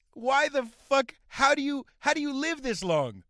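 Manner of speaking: loud, high-pitched incredulous outburst; exasperated rant. Real, not acted. Scene: comic ranting at the woman who rejected his text, exasperated. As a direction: demand answers, exasperated and loud. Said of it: "rant that f-, long at her".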